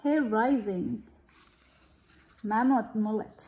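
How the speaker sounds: ordinary delivery, nothing marked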